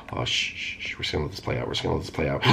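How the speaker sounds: soft low hush. Real, not acted. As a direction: keep it down so he won't hear. Hiding, hushing while watching.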